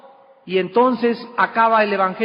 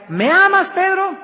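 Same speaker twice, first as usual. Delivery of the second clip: "¿Me amas, Pedro?" asked much higher and pleading; pleading third ask; 10 semitones higher than usual. Retold or imitated. Jesus asking Peter a third time, high and pleading. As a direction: press the question up high, insisting. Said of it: "le vuelve a decir por tercera vez".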